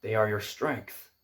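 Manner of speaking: put-on soft, low Irish-accented horse; quiet Irish accent, lightly put on. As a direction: speak gently in an Irish accent, trailing to a near whisper. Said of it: "do it better in his Irish accent".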